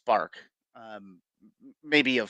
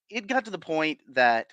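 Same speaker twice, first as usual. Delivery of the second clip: ordinary talk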